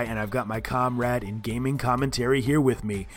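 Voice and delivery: ordinary talk, nothing marked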